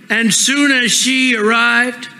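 ordinary talk, nothing marked

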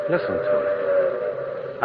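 slow, breathy hush. Acted. Lonely man hushing the listener to hear the whistle. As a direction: draw them in with a hush.

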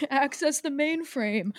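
put-on slow, soft old man voice; a hint of an old man, slow. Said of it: "doing old man voice".

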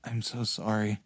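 tearful apology. Acted. Tearful goodbye to fallen friend.

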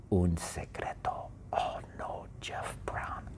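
soft conspiratorial whisper; secret whisper. Acted. Teacher hiding the prize from the class, whispering.

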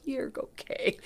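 imitated soft, breath-only reassurance; reassuring whisper. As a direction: whisper it gently, retelling her comfort. Girlfriend reassures her in story.

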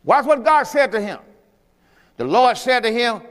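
ordinary talk, nothing marked

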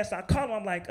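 normal talking voice

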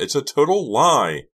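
loud, high-pitched wail of protest. A narrator's reading. Karina fights the boys' grip, desperate wail. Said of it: "she wailed".

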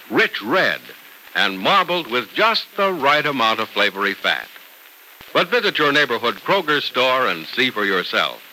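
usual speaking voice

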